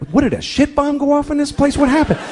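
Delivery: high, incredulous what-happened; shocked gawker. Retold or imitated. Driver passing through a wrecked town, stunned and high. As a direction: gawk at the ruined town in disbelief.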